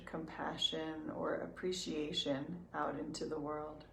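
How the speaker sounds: soft, unhurried meditation voice with long pauses; calm, even guidance, leaving room to breathe; guided meditation. Real, not acted.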